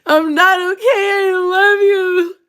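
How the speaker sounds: high, slow hammed-up crying; mock sobbing plea. To her man, a hammed-up sobbing plea. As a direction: wail it like a wrecked ex begging. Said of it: "that's exactly my face".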